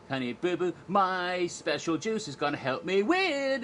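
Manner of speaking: put-on high, excitable child pageant contestant; Honey Boo Boo. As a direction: crow like a pageant brat. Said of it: "Honey Boo Boo Child comes running out".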